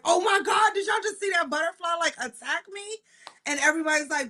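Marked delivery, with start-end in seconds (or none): 0.0-2.8 freaked out by a butterfly; startled exclaim. Retold or imitated. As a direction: shriek like it just hit you. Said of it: "and I'm like".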